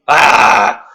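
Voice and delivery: loud startled ah; a sudden delighted yelp; surprised yelp. Real, not acted. Comedian reacting to the twist, startled yelp.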